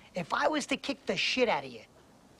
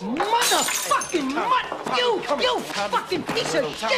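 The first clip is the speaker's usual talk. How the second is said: high, loud, slowed shriek of rage; screaming at the fake tough guy while held back; enraged scream; 12 semitones higher, 4 dB louder, much slower than usual. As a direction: tear into him at full voice. Enraged at an old enemy.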